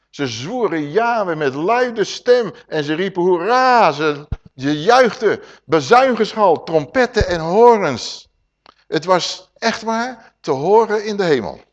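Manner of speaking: loud, high, exultant scripture read; rejoicing proclamation; jubilant reading. Acted. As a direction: let the cheering ring out. Reads the jubilant oath scene.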